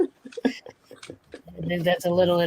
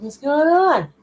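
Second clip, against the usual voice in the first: surprised; 8 semitones higher, 8 dB louder than usual. Retold or imitated. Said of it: "You all looked up too, like".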